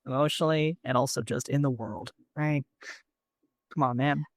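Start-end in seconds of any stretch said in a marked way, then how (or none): none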